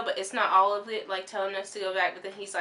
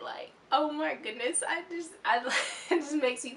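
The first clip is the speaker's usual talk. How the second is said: high voice breaking into laughter; laughing upset; 6 semitones higher than usual. Real, not acted. Telling viewers about the night, laughing and pitched up. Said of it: "I was so upset".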